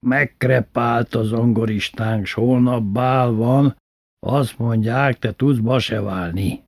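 slow, matter-of-fact primás; Kálmán bácsi explaining the dead pianist; slow bandleader. Retold or imitated. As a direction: lay out the problem slowly. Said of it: "mondta édesapának".

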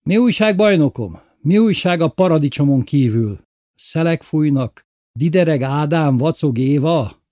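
mocking tease. Lili mocking the girl.

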